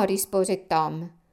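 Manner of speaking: plain reading voice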